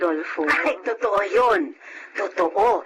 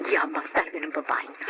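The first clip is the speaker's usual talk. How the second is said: soft, low grumble under her breath; wife grumbling softly about the show-off; muttered aside; 5 semitones lower, 5 dB quieter than usual. Acted. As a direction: grumble so the guest won't hear. Aside about bragging guest.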